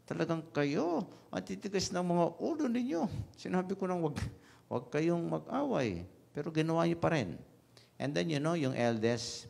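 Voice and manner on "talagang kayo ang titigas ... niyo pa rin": much higher, hammed-up scolding mother's voice, faster by the end; mock scolding mother. Mother scolding her two fighting sons, hammed-up and much higher. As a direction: play the exasperated mom telling off her stubborn boys. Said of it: "pinagalitan itong dalawang anak".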